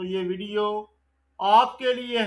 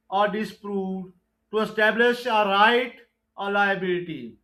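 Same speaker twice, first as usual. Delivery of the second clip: slow, deliberate exam-question read; measured MCQ recitation; slow quiz reading; 2 semitones lower, 3 dB louder, much slower than usual. Acted. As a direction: dictate each question and option slowly. Reads definitions slowly.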